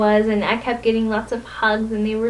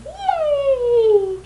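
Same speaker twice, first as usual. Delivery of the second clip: squealed yay. Real, not acted. Cheering about new friends.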